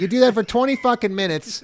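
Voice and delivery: ordinary talk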